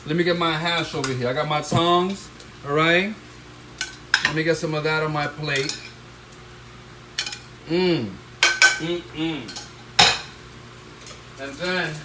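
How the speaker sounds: usual speaking voice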